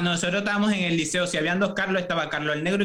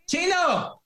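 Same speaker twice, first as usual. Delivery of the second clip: calling out; 10 semitones higher than usual. Retold or imitated. Calling waiter across restaurant.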